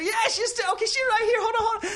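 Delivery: very high, flustered-with-excitement father; excited Chinese-accented dad. Retold or imitated. Dad fetching mom to the phone, excited high voice.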